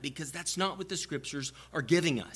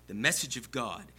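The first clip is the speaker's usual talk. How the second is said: ordinary talk, nothing marked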